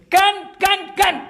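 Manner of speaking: squeaky, hammed-up old husband answering back; old husband's reply. Old husband answering his wife in the park, high mock voice. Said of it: "বুড়োটা বলছে".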